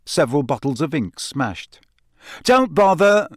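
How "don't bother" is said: loud, high exasperation; exasperated. A narrator's reading. Cedric to friends helping with his spilled bag, exasperated. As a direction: snap it out, fed up. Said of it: "in an exasperated voice".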